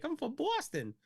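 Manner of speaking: high-pitched imitated Boston accent. Player retelling a Boston opponent's introduction, imitated Boston accent. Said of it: "And he's like".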